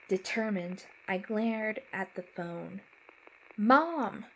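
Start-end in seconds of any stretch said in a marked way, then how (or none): 3.6-4.2 loud, raised cry for Mom; calling out. A narrator's reading. Child calling lost mother on phone, urgent shout. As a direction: call urgently into the static.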